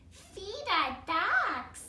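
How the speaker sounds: put-on high, slow little baby voice; Baby Blair voice. Reading to the class as the baby, slow and high. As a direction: speak it slow like a toddler. Baby bear voice in read-aloud.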